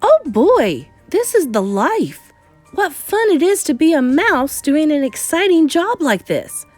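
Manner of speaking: high, swooping delight; thrilled little adventurer, relishing every word; gleeful exclaiming. Acted. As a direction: revel in it with big pitch swoops. Mouse thinks gleefully to himself.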